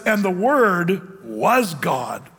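very slow, "Word" held and weighed; slow solemn reading. Shown as a demonstration. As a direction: read the verse out word by word. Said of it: "It says".

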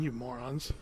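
very soft, low sulky grumble; grumbling aside. Retold or imitated. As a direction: grumble it under the breath, off to the side. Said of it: "Moses is standing off the side, leaning up his tree, just going".

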